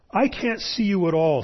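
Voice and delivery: high, strained protest; frightened boy. A narrator's reading. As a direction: call out anxiously into the dark. Frightened boy addresses unseen thing.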